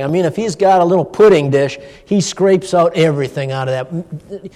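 ordinary talk, nothing marked

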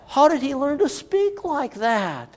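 lightly mimicked amazement; mock amazed townsfolk. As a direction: echo their shallow wonder slowly. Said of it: "Forget that".

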